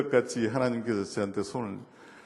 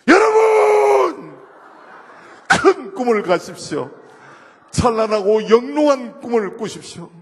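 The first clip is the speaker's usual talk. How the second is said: far higher, much louder, drawn-out preaching roar; revival preacher shout; 8 semitones higher, 9 dB louder, slower than usual. Retold or imitated. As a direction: bellow it like a revival preacher at full force. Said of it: "흉내를 한 십 초만 내보고 싶어요". (부흥회 목사님 고함 흉내)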